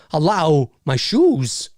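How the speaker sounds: halting impression with odd stretched words; Christopher Walken. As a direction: break the line up oddly, drawing words out slowly. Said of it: "in the Christopher Walken voice".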